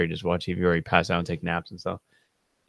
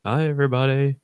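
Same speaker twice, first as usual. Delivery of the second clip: high, hammed-up hello; put-on, showy greeting in a raised pitch; imitated flamboyant greeting; 6 semitones higher than usual. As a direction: greet the room with exaggerated flair.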